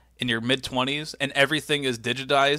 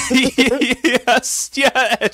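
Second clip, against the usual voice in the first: laughing yes; 10 semitones higher, 8 dB louder, much slower than usual. Real, not acted. Laughing excited agreement.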